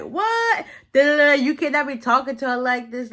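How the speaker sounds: high-pitched mock outrage; indignant outburst. Imitating son storming out to defend her, indignant. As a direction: burst in high, scolding and protesting.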